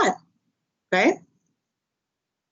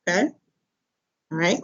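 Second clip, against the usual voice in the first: ordinary delivery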